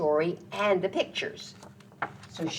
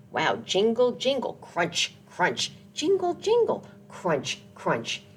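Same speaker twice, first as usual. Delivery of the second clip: sleigh sound chant; 2 semitones higher than usual. Acted.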